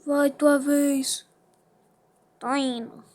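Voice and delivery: ordinary talk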